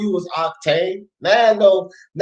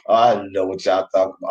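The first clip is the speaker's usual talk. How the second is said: usual speaking voice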